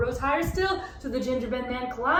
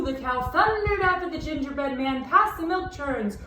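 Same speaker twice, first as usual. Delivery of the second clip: ordinary delivery, nothing marked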